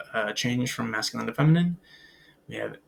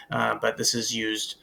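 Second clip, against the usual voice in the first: normal talking voice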